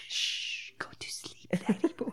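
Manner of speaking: hushed unvoiced whisper, pitch rising at end; whispering over a crib. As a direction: whisper him to sleep.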